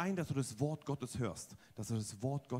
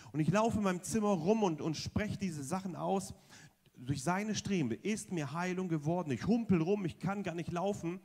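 ordinary talk

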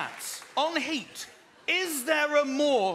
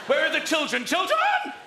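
put-on frantic shout, jumping much higher on Children; calling for the children; 5 semitones higher, 5 dB louder than usual. Lost in the dark field, husband calls out for his children, frantic shout. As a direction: yell into the dark for the lost kids.

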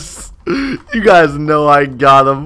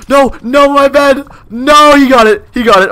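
high, loud panicked no; panicked shout; 11 semitones higher, 6 dB louder than usual. Real, not acted. Streamer panicking as rival breaks bed, shouting. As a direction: yell as the bed goes.

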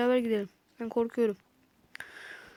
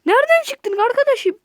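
much higher and louder, stunned; startled outcry; shocked shout; 11 semitones higher, 8 dB louder than usual. Real, not acted. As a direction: yell at the surprise enemy. Enemy pops out unexpectedly.

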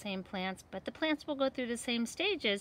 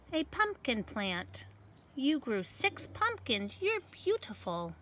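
lively seed voices for a picture book; talking seed characters; 5 semitones higher, slower than usual. Acted. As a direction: voice the little seeds for kids. Seeds celebrate in picture book.

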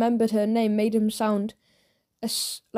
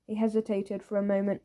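plain reading voice

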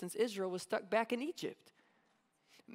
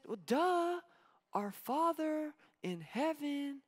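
put-on high, slow child reciting the prayer; a little boy stating the obvious; matter-of-fact kid; 6 semitones higher, slower than usual. Kid reciting the prayer to his mom. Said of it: "he says".

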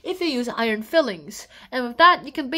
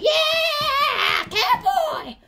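very high, with a laugh; whooping yell; 13 semitones higher, 3 dB louder, much slower than usual. Pretend. Finishing the joke song, whooping cowboy yell.

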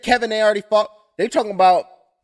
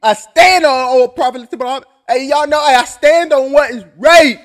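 loud, preachy impression of a fighter, building to a shout; hammed-up fighter preaching his principles, louder and louder; imitating Leon; 3 semitones higher, 6 dB louder, slower than usual. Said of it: "He'd be like, um".

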